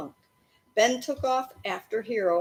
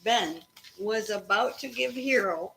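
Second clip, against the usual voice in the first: usual speaking voice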